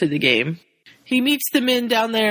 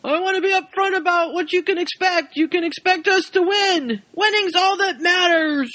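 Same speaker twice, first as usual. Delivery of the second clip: high, barked drill sergeant yell; 11 semitones higher than usual. Retold or imitated. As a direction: bark at the recruits like a sergeant. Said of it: "he's like a drill sergeant".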